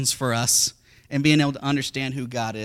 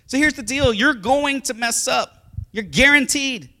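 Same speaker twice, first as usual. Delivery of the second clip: loud, high preaching; full-force preaching; 8 semitones higher, 7 dB louder than usual. Real, not acted. Pastor to congregation, full-force preaching. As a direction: drive the point home.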